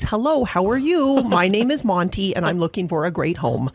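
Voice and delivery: ordinary talk, nothing marked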